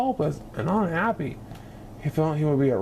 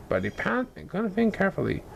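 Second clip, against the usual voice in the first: ordinary delivery, nothing marked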